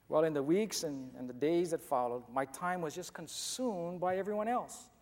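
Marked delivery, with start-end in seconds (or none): none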